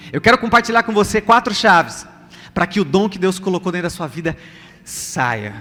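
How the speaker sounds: normal talking voice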